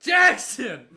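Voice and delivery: loud high call of a name. Retold or imitated. Robbie calling out to Jackson across class, loud. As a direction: yell the name across the room.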